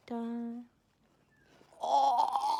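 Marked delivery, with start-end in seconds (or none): 1.8-2.6 drawn-out "oh" breaking into laughter; real laugh of amazed delight; delighted gasp and laugh. Real, not acted. Opening a gift shell from a friend, delighted laugh.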